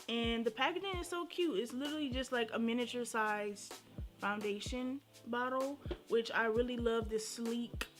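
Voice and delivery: ordinary delivery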